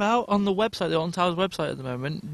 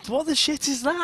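high, slow and baffled; incredulous; 7 semitones higher than usual. Real, not acted. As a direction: ask it in disbelief. Reacting to a strange clue box.

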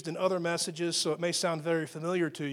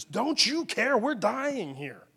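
mock panicked disciples; 3 semitones higher, slower than usual. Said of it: "They're yelling at Jesus".

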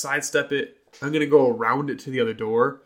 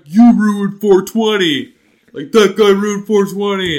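higher, louder and slow mock yell; retold scream; 7 semitones higher, 10 dB louder, slower than usual. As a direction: stoner yells across cafeteria. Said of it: "screams across the cafeteria".

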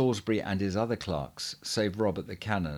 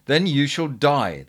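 louder, slow, menacing cry; loud threat; 4 semitones higher, 7 dB louder than usual. A narrator's reading. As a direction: spell out the death sentence, slowly and loud. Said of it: "they cried".

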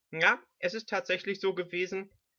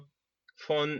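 usual speaking voice

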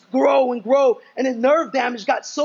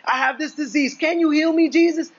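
pleading question, pitched up high at the end; imagined leper's plea; 6 semitones higher than usual. Retold or imitated. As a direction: ask Jesus almost begging. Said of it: "No, he says what?".

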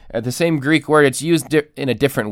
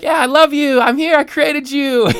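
much higher, louder, sing-song reassurance; doting creator; 5 dB louder than usual. Retold or imitated. Creator answering his clay man in a high, doting voice. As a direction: coo down at the little clay man. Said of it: "and I'm like".

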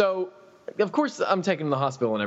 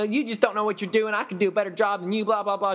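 mock customer nagging; 6 semitones higher than usual. Mimics the customer.

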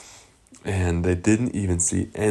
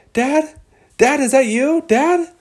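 put-on very high questioning child-fish voice; little fish calling; 3 dB louder than usual. Lost Nemo calling his dad, high questioning voice. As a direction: call hopefully, pitched way up.

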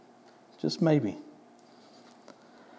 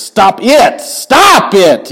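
much louder, higher repeated Stop it; mock parental yell at the kids; parent scolding kids; 9 semitones higher, 9 dB louder, much slower than usual. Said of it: "different, like, inflections".

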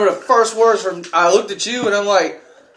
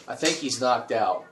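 quieter and slower, like a worried onlooker; hushed crowd murmur; 7 semitones lower, 10 dB quieter, slower than usual. Retold or imitated. Crowd member worried about the knocked-out wrestler, hushed. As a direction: murmur it low as if ringside. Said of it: "You hear other people around going".

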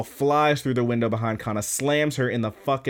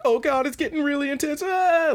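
very high sustained squeal into a scream; hammed-up girlish panic; mock freaking out. Girls watching the fight get intense, squealed mock panic. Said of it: "the girls are really freaking out".

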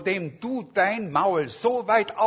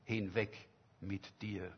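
the living God dismissing us, softer and lower; grave hush; 8 semitones lower, 12 dB quieter than usual. Shown as a demonstration. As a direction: let it fall quiet and heavy like a verdict. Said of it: "der zu uns einmal sagen könnte".

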